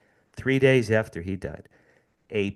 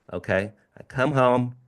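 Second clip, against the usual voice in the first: ordinary talk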